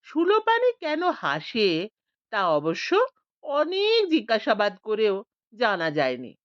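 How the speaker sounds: ordinary delivery, nothing marked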